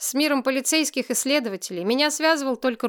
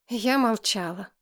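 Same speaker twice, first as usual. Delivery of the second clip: ordinary delivery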